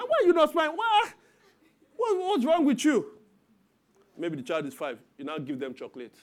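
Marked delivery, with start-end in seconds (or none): 0.0-3.1 high, loud, half-laughing why-aren't-you-smiling; hammed-up pushy parent nagging; mock exasperated parent. Parent nagging a hurt child to smile, loud and exasperated.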